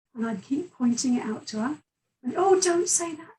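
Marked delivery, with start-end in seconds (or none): 2.4-3.4 mum's pleading voice, pitched up; begging her daughter not to say it, voiced higher; mother's plea. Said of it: "she'd say".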